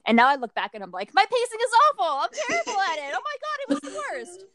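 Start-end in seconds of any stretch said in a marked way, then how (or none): 1.1-4.4 mock horror. Author looking back at her own book, mock horror. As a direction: wail it, hammed-up, much higher than usual.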